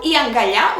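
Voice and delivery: "iangalha" with the accent landing on its last syllable; last-syllable stress. Shown as a demonstration. Teacher shows learners an example noun, stress on the last syllable. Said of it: "has an accent at the last syllable".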